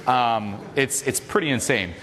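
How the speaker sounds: ordinary talk, nothing marked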